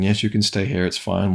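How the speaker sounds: ordinary talk, nothing marked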